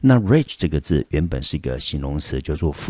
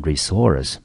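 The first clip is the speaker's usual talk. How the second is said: resource with the weight on source; model pronunciation. Shown as a demonstration. Teacher sums up how to pronounce resource. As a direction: say re short, then land on source. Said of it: "所以这个字呢，我们就念成resource".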